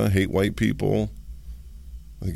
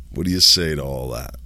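ordinary delivery

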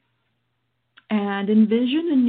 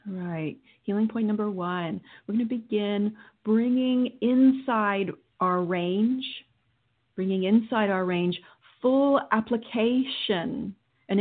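guided meditation. Acted. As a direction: guide them through calmly.

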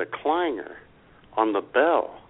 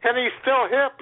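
gleeful; 10 semitones higher than usual. Real, not acted. Joking praise of Profucius.

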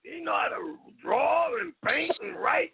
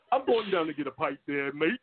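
deep, much lower Cockney imitation; mock Cockney; 11 semitones lower than usual. Caller imitating the guest's accent, mock Cockney. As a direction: drop right down into a put-on Cockney voice. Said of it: "I can hear the Cockney in his voice".

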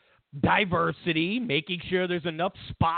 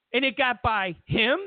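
ordinary talk, nothing marked

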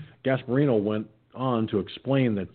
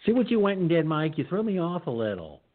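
mock scolding; 6 semitones higher than usual. Real, not acted. Teasing Mike for laughing.

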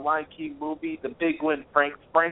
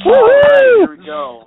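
excited shout; 17 dB louder, slower than usual. Real, not acted. Excited launch of segment.

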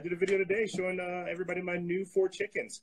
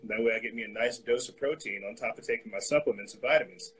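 usual speaking voice

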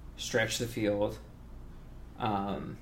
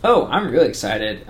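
excited; 2 semitones higher, 10 dB louder than usual. Real, not acted. Answering with real enthusiasm.

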